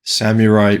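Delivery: "Samurai" said as SAM-yuh-rye; "Samurai" retried with a y-glide after the m; careful corrected pronunciation. Shown as a demonstration. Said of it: "I'll try that again".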